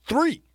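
Three popped up high; emphatic three. Real, not acted. Hyping Gronk's Super Bowl, an emphatic Three. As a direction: hit Three up high and bright.

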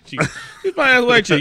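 usual speaking voice

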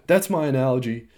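ordinary talk, nothing marked

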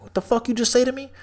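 imitated angry question; mock hostile retort. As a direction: quote the stranger's menace again. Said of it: "He's like".

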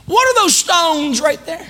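high, loud, amazed asking; wide-eyed question. Shown as a demonstration. Grandpa prompting grandson to ask about stones, amazed. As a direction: ask it like a wondering child. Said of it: "Say it".

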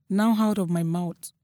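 plain reading voice

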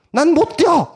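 high, loud flat refusal; defiant shout. Pretend. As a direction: yell it like a flat no.

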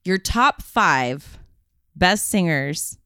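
big awards-show announcer voice; countdown announcer. Pretend. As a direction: call out each name like an MC. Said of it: "You got that voice".

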